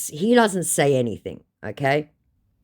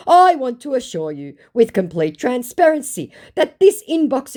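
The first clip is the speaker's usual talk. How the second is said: mock scammer voice; 6 semitones higher, 4 dB louder than usual. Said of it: "To which he says".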